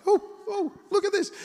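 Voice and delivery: high-pitched playful calling out; hammed-up excited "oh, oh"; excited onlooker. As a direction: pop up with eager mock wonder. Men poking heads through roof.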